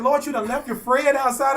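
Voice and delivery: hammed-up shocked Brandy; imitating Brandy. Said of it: "She said".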